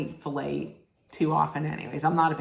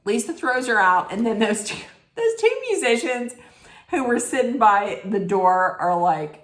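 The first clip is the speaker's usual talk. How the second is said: ordinary talk, nothing marked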